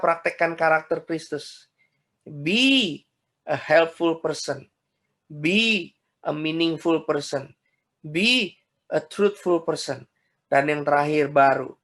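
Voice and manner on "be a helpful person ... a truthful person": slow emphatic refrain. Acted. Preacher's slow English refrain.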